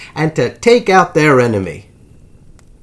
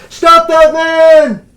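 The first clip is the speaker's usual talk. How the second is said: high, loud, drawn-out shout; crying out; 12 semitones higher, 9 dB louder, much slower than usual. A narrator's reading. Rathbone yelling after the fleeing Booth, urgent cry. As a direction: bellow it across a theater to halt a fleeing man. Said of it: "Rathbone cries out".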